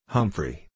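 "Humphrey" with the first syllable sounded just as written, falling; "Humphrey" read out letter-true, falling tone; pronounced as spelt. A narrator's reading. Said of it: "that is how he said it".

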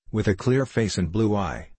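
ordinary delivery

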